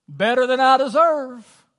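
high, loud, slow, sing-song churchy reply; overdone pious churchgoer; pious mock answer.

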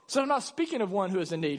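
usual speaking voice

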